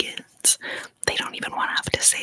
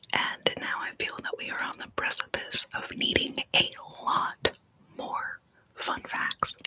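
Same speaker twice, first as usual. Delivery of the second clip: ordinary delivery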